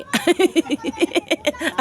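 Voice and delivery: real high, loud giggling fit; laughing. Real, not acted. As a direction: giggle helplessly mid-climb. Laughs while climbing.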